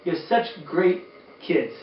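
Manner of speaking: ordinary delivery, nothing marked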